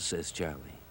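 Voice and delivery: ordinary delivery, nothing marked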